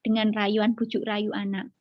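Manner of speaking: ordinary talk, nothing marked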